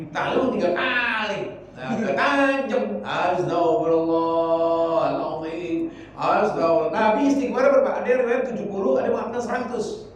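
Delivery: loud, urging sermon voice, drawn out on the prayer words; fiery preaching. Acted. Preacher urging the congregation to repeat istighfar, loud and fervent. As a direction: preach it out to the whole room.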